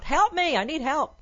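high-pitched mock scream; retold high plea for rescue; imitated cry for help. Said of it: "she's screaming out".

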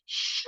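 drawn-out hushing sound; imitated shushing. Audience shushing the laughing pair at ceremony, imitated shush. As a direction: hush someone as an annoyed audience would. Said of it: "people are like".

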